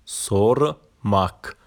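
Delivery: normal talking voice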